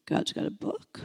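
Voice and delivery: whispered disbelief. Retold or imitated. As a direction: breathe it out, stunned. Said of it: "I'm like".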